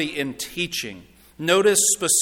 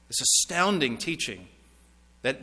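ordinary delivery

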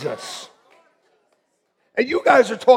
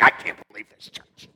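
whispered, low mock complaint; mock grumble; 11 semitones lower than usual. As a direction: mutter it like an offended churchgoer. Retold grumble over coffee.

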